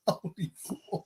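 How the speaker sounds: breathy whispered swear of disbelief; whispered curse. Retold or imitated. Stunned at a tax-free contract, whispering. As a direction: hush it like a stunned aside. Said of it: "I was going".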